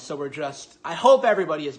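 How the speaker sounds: ordinary talk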